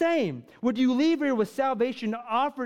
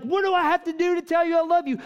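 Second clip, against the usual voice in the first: pleading billionaire; 5 semitones higher, 4 dB louder, much faster than usual. Retold or imitated. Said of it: "he's like".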